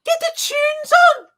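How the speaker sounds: very high, loud Scouse yelling; Scouse shout. Acted. Scouser demanding music, hammed-up high Scouse shout. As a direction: screech it like an over-the-top Liverpudlian, stretching the end. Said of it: "the Scouse accent just goes through me".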